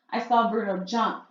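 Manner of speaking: usual speaking voice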